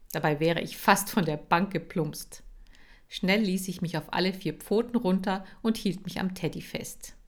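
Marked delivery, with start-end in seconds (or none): none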